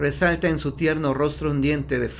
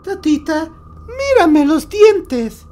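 put-on high, loud ghoulish child voice, slowing down; voice from beyond the grave; 13 semitones higher, 8 dB louder, slower than usual. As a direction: sing out the threat from the grave, slow and loud. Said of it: "dice con voz de ultratumba".

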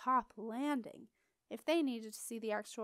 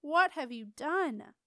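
scolding; 4 semitones higher, 8 dB louder, slower than usual. A narrator's reading. Scolding the dragon.

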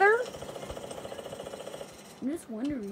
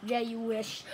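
ordinary talk, nothing marked

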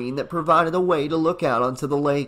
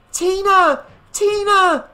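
very high, loud frantic scream; 6 dB louder than usual. A narrator's reading. Frantic mother seeing daughter alone on the lake, scream. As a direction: scream the name at full voice. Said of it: "she screamed at the top of her lungs".

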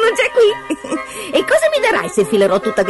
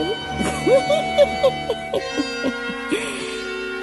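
long, very high sly giggle; imp's giggle; 4 dB quieter than usual. Acted. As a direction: giggle slyly at length. Risatina del nano. (lunga risatina acuta da nano)

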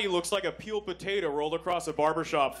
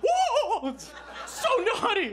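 much higher, thrilled squeal; mock delighted girlfriend; 4 dB louder, much slower than usual. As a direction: squeal it like she loves it.

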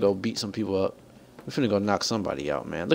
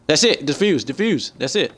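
loud, higher-pitched urgent command; urgent urging; 4 semitones higher, 4 dB louder than usual. Real, not acted. Gamer telling teammate to defuse, urgent and excited. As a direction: urge a teammate on with real urgency.

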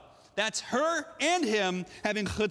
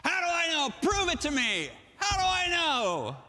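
high, insistent demand for proof; acted high-pitched defiance; brazen chutzpah; 9 semitones higher than usual. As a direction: challenge God boldly, stretching the last question. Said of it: "That's chutzpah".

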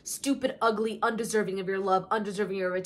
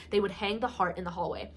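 ordinary talk, nothing marked

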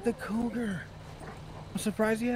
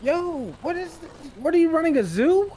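higher and louder, disbelieving; shocked outburst; 7 semitones higher, 5 dB louder than usual. Acted. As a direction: blurt it out in shock at the zoo. Stranger alarmed by the animals.

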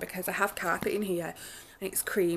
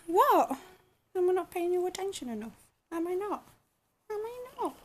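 high cooing pet voice; 10 semitones higher than usual. Real, not acted. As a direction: coo up high at the cat. Talking to her cat.

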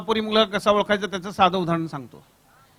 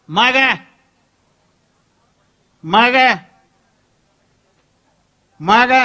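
impatient demand; 3 semitones higher, 8 dB louder, much slower than usual. Acted. Brother pressing sister for answer.

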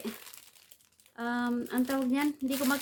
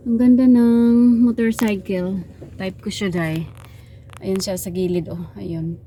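ordinary delivery

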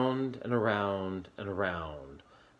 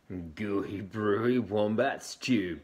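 louder slow boast; 6 dB louder, slower than usual. Acted. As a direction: show it off slowly. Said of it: "boasted Dingo".

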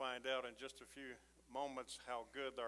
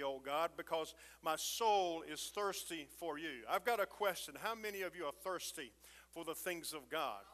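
louder, higher, rhythmic preacher's cadence; full-force preaching; 4 semitones higher, 6 dB louder than usual. Acted. Pastor preaching the Mountain Dew sermon to his church, fired up. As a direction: build the fire and keep it rolling.

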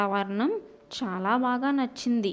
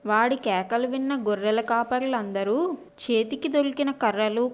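ordinary delivery

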